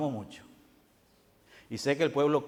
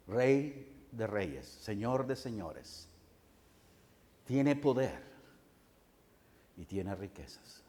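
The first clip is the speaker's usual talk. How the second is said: slow, soft, lower titles of majesty; solemn hush; 5 semitones lower, 8 dB quieter, slower than usual. Acted. (voz baja y solemne, con reverencia)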